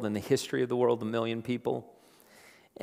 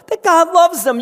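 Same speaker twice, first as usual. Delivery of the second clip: that God loves them, much higher and louder, stress on loves; soaring preached repeat; 14 semitones higher, 11 dB louder than usual. Acted. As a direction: launch it up into a cry.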